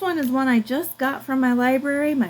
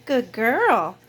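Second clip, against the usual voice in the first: normal talking voice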